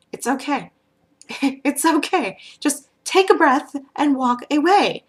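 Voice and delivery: soothing a friend on the edge of a fight. Retold or imitated. Calming friend about to fight.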